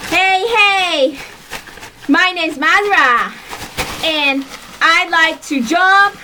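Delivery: high, chanted hammed-up cheer; high-pitched mock cheerleading routine; pretend cheerleader. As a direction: belt out a clumsy homemade cheer, building to a yay. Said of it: "a cheerleader".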